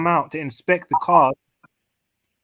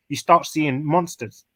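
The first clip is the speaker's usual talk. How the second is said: normal talking voice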